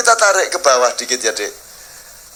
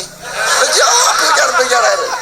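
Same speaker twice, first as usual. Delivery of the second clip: laughing shout; 5 semitones higher, slower than usual. Acted. Mocking the brave parent.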